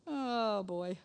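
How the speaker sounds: ordinary talk, nothing marked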